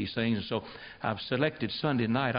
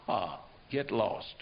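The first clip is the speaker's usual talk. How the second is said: dismissive laugh; 4 dB quieter, much slower than usual. Pretend. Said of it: "you can say".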